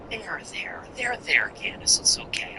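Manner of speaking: hushed, whispered pretend comfort; mock soothing. Comedian pretending to console Candace, mock-soothing whisper.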